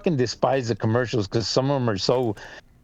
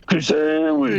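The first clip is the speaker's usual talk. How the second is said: imitating the ad guy; 3 dB louder than usual. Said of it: "the way he said it".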